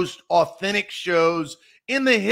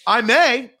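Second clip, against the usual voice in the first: ordinary talk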